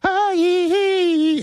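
high falsetto yee; falsetto yelp. Acted. Host whooping up high for the summer intro.

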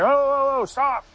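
high, drawn-out imitation of yelling; retold yell. Bystanders calling after the rescuers to stop, mock yell. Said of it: "we heard some people yelling".

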